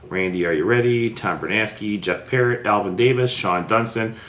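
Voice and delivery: ordinary talk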